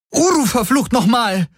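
very high exasperated yell; angry scream. A narrator's reading. Kirai curses her unmoving mount, screaming. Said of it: "schrie sie".

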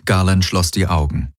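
usual speaking voice

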